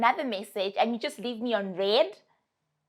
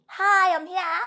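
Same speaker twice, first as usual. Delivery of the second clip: much higher, louder bright chirp; mock chirpy hello; 8 semitones higher, 5 dB louder than usual. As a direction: play the ghost popping back up cheerfully. Ghost switching back on.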